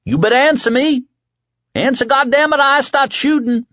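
high-pitched, threatening yell; shouting. A narrator's reading. The kid threatens whoever is out there, shouting high. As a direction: yell a frantic warning into the dark. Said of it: "the kid shouted".

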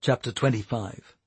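ordinary delivery, nothing marked

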